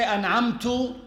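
"an'amtu" ending on an u vowel; damma ending. Shown as a demonstration. Teacher showing a meaning-changing error, damma ending. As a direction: finish "an'amtu" on -tu instead of -ta. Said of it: "it changes the meaning".

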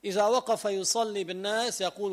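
usual speaking voice

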